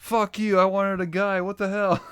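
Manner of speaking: hammed-up furious rant; mock angry guest. Talk show guest yelling at a partner, mock anger. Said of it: "acting talk show guest".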